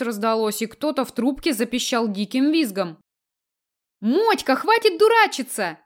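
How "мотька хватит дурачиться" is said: high, giggly scolding; giggling. A narrator's reading. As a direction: giggle through a playful telling-off. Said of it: "захихикала мишень".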